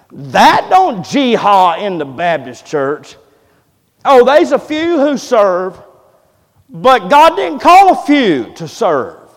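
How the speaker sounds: high, loud, slow preacher's cry; full-force preaching. Acted. Pastor urging the church to serve, preaching high and loud. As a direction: lift it and hammer each word home.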